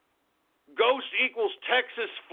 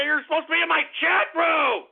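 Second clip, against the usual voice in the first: indignant shout; 6 semitones higher than usual. Real, not acted. Streamer scolding his viewers, indignant shout. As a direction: yell it at the chat. Yelling at chat.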